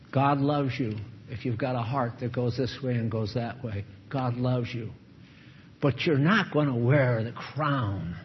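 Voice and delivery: ordinary talk, nothing marked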